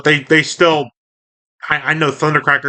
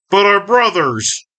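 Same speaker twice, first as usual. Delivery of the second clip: higher, slow mock plea; Ratchet; 6 semitones higher than usual. Ratchet objecting to Optimus's retreat, mild drawn-out plea. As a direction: draw it out in lightly acted protest. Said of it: "And Ratchet's like".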